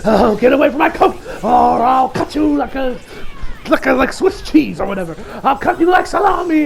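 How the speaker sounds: lightly voiced unhinged junkie, loud and laughing; crazy junkie. Drugged man waving a knife, a mock crazed threat. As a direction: threaten wildly, cackling a bit. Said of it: "this dude's kinda crazy".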